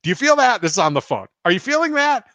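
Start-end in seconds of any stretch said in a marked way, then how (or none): 0.0-0.6 eager asking. Retold or imitated. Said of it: "I was asking her". 1.4-2.2 eager asking. Retold or imitated. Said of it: "I was asking her".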